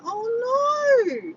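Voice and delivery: high, loud drawn-out cry of dismay; real dismay. Real, not acted. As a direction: wail it as bad news sinks in. Realises she missed friend's loss.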